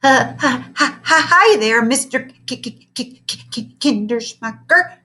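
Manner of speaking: high, loud, stuttering fake calm; nervous stammer. A narrator's reading. A scared teacher greeting his old teacher, stammering high. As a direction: stammer while pretending to be casual. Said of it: "tried to sound nonchalant".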